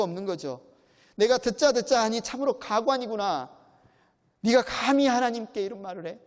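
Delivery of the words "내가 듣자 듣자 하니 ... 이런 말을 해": louder, higher scolding with "감히" pushed up; raised indignant reprimand; indignant rebuke. Acted. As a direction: scold him like an offended elder. Bildad rebukes Job.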